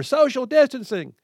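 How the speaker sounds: high, mock child's yell. Host imitates the four-year-old son yelling at a child. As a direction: shout it like a scolding little boy. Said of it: "he yelled at her".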